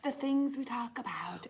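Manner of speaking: whispered aside, half laughing. Real, not acted. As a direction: breathe it out as a secret. Laughing aside about their topics.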